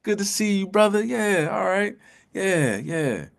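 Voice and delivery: high-pitched, chummy greeting; over-friendly hello in a high voice; fake best friend. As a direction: greet them like a best buddy, pitched well up. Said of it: "They could be your best friend".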